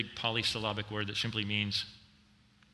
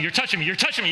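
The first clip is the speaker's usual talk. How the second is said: 1 frantic sheep; 8 semitones higher, 9 dB louder, much faster than usual. Acted. Sheep voiced resisting shepherd.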